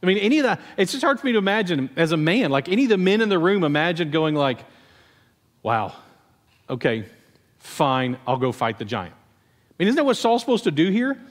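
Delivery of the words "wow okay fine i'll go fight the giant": low, resigned mock acceptance; reluctant giving-in. Men in the room imagine facing the giant, reluctant. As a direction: give in grudgingly, with pauses between words.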